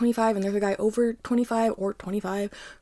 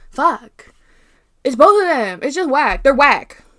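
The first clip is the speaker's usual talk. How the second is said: louder, worked-up outburst; heated rant; 3 semitones higher, 8 dB louder, slower than usual. Real, not acted. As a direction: vent your real disgust at the parents. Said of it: "I'm hot".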